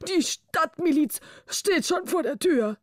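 high, fast, breathless royal scolding; exhausted angry king. A narrator's reading. King out of breath, scolding Pecavus. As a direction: spit it out after the chase. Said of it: "rief der König erschöpft".

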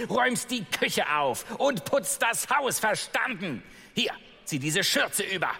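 high, bossy stepmother giving orders. Acted. Stepmother commanding Ella to do chores, high and bossy. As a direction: order the girl around from on high. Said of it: "rief die Stiefmutter nach ihr".

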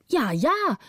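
high, playful 'ja, ja'; teasing agreement. Acted. The orphan humours the youngest girl, high and playful. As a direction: humour the little one. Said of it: "sagte die Waise".

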